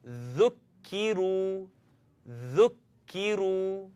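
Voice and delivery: ذكروا drawn out slowly, each letter clear; teacher modelling ذكروا slowly for students; slow Arabic demo. Shown as a demonstration. Teacher models ذكروا slowly for learners to copy. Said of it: "let's take one more".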